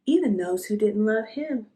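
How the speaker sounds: ordinary delivery, nothing marked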